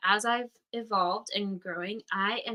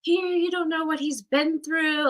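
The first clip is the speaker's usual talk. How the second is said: high, loud, slowed angry plea; a kid snapping back in his defense; pissed outburst; 8 semitones higher, 6 dB louder than usual. Retold or imitated. Kid in the car angrily defending the homeless man, loud and high. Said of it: "I was sitting in the car pissed".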